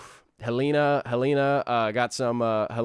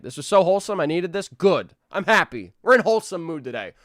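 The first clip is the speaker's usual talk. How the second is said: ordinary talk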